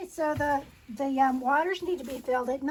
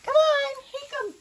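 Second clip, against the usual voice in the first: calling goats; 9 dB louder than usual. Real, not acted.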